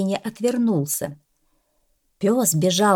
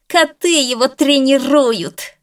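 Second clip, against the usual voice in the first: high, loud, slow jeering; mock them openly; the bulldog sneering at the cats training a dog; snide; 8 semitones higher, 6 dB louder, slower than usual. A narrator's reading. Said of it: "ехидно продолжил маленький французский бульдог".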